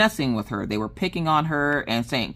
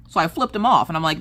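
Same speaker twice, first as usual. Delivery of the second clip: normal talking voice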